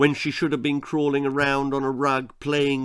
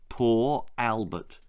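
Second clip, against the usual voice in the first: ordinary delivery, nothing marked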